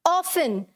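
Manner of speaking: often said as OFF-un, no T sound; often pronounced without its T; often with silent T. Shown as a demonstration. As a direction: say often like "awfun", the T left silent. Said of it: "पहला word मेरा क्या है".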